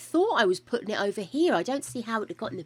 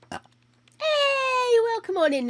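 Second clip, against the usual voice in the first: Hey pitched way up in a cheery welcome; high bright greeting; 13 semitones higher, 4 dB louder, slower than usual. Real, not acted. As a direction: sing out a welcome to a guest. Greeting a visiting sim.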